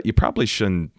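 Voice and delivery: ordinary talk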